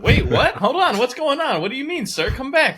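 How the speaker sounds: high-pitched imitation of a startled receptionist; very confused. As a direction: call after him in loud, high bewilderment. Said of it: "they were all very confused".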